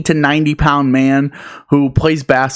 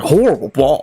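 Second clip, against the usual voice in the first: mock affronted echo of an insult; puffed-up indignation. Stranger throwing the insult back with chest out, mock indignation. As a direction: repeat it back in puffed-up disbelief. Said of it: "he puffed out his chest".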